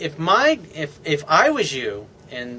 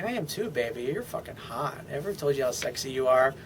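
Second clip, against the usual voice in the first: soft seductive reply; 2 semitones lower, 7 dB quieter than usual. Acted.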